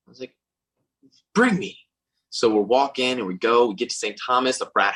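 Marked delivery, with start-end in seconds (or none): 1.3-1.8 high, louder bring me; mock desperate plea. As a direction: beg eagerly in a high voice. His desperate reply, high.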